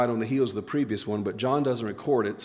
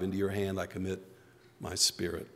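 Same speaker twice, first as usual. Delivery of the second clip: quiet quoted prayer; 4 semitones lower, 7 dB quieter than usual. Retold or imitated. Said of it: "probably was much quieter".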